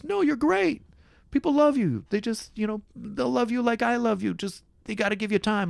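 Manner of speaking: very high, pleading reassurance; acted earnest reassurance. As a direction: reassure your buddy way up high. Scene partner reassures him in improv.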